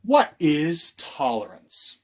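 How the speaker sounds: plain reading voice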